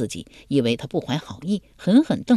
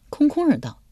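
plain reading voice

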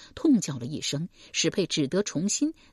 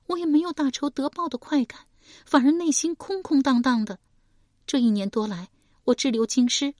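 put-on higher, grieving young woman's voice; young woman recounting her mother's murder, higher voice; young woman Shi Pei; 9 semitones higher than usual. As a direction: tell it as a wounded daughter.

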